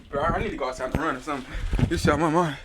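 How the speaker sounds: ordinary talk, nothing marked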